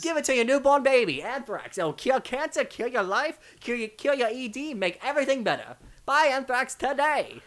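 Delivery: hard-sell it like a cheesy salesman. Shown as a demonstration. Selling Anthrax bit, loud high salesman pitch. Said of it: "that's the only voice I can do".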